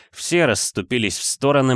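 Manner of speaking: ordinary delivery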